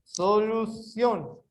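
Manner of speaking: "Solução" slowly, in measured syllables; deliberate syllable breaks in "Solução"; separated into syllables. Shown as a demonstration. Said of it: "separar em sílabos".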